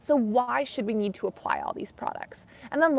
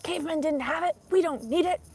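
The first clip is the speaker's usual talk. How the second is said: higher mocking voice of a critic; mock arguer; 6 semitones higher than usual. Mocking people who argue skincare is unnecessary. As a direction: mimic the naysayers lightly. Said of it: "some people would argue".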